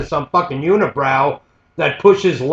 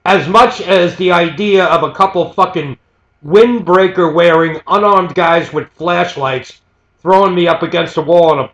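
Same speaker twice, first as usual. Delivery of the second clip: usual speaking voice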